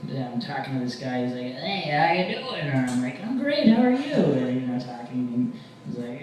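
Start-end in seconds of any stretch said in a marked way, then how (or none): 1.6-2.6 high, drawn-out greeting; friendly street guy. Acted. Vagrant greeting the comic on the street, friendly high voice. 3.2-4.1 very high-pitched friendly gush; cheery reply. Acted. Comic answering a friendly vagrant, high cheery gush.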